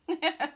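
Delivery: real short, high chuckle; amused chuckle. Real, not acted. Narrator amused by the fretting golfer, real chuckle. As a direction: chuckle at your own character.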